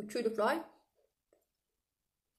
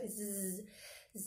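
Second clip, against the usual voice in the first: soft buzzing like a fly; buzzing fly zzz; 10 dB quieter than usual. Shown as a demonstration. Teacher demos the fly buzz for the class. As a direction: buzz through the teeth like a fly. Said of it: "you need to make a zzz sound".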